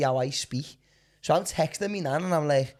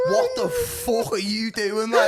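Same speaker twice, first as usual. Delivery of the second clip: higher-pitched, drawn-out mock outrage; incredulous confrontation; 4 dB louder, slower than usual. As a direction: demand an explanation, half laughing. Retold confrontation of housemate.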